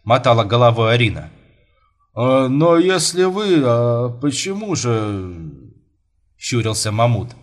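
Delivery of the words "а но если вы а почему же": slow, stretched, dithering voice; wavering father. Acted. Father wavering between suitor and daughter, slow and flustered. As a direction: dither with long drawn vowels.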